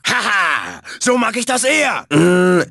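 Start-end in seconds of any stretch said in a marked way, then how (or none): 0.1-0.8 low, drawn-out villain laugh; gloating laugh. Acted. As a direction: laugh smugly at the victim.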